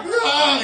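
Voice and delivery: high loud yelp of panic; panicked yelp. Retold or imitated. Kid running to the bathroom in panic, high yelp. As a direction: let out a high panicked cry.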